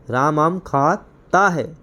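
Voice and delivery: very slow, pause inside खाता before ता; खाता split and drawn out for teaching; singular ending stressed. Shown as a demonstration. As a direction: break खाता into खा, then ता है. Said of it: "singular है".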